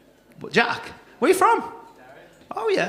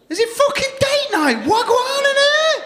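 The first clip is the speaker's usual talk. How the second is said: mock excitement. Comic to a couple in the crowd, mock excited. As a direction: squeal it high to tease them.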